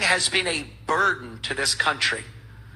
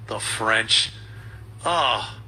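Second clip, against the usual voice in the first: ordinary talk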